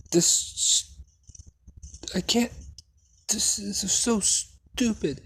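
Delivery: high, halting mock frustration; frustrated. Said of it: "getting more and more, uh, frustrated".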